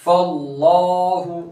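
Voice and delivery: slow chant of फल्लाहु with a full लाम; heavy lam recitation. Shown as a demonstration. Teacher recites the example slowly. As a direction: draw it out with a thick lam. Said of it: "ये भी लाम पूर होगा".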